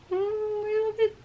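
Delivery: high, tiny squeal of love; adoring squeal. Real, not acted. Collector smitten with her new pin, adoring squeal.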